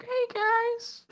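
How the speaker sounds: very high squeaky greeting; imitating a squeaky cartoon character; high-pitched squeaker. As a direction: squeak it out far above your normal pitch. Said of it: "sound like a squeaker".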